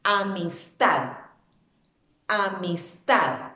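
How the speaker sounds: "Amistad" drawn out slowly, weight on the final "tad"; stress on last syllable. Shown as a demonstration. Teacher proving the word is aguda, "Amistad" stressed on "tad". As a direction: lean hard on "tad" in "Amistad". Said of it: "su fuerza de voz recae sobre la última".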